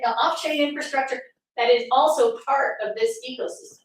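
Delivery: ordinary delivery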